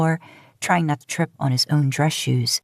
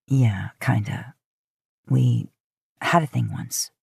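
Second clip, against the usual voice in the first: lower, slow hesitant admission; reluctant Dean; 5 semitones lower, slower than usual. Acted. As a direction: confess it slowly and low.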